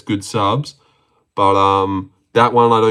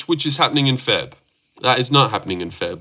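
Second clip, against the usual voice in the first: ordinary talk, nothing marked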